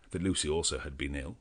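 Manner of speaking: ordinary delivery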